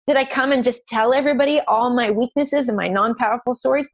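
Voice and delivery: usual speaking voice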